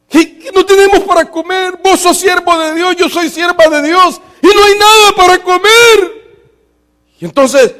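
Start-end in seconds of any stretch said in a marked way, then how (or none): 0.1-6.2 a higher, tearful plea from the weeping wife, rising louder at the end. Retold or imitated. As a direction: mimic a crying wife complaining there is no food. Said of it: "su esposa la halló llorando".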